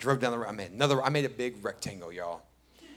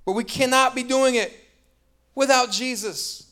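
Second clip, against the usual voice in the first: high, loud rallying call; preaching at full force; 8 semitones higher, 5 dB louder than usual. Acted. Preacher rallying the church on racism, loud and high. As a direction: build the church to a peak.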